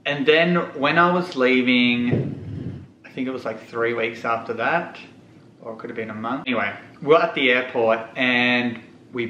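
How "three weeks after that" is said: ordinary delivery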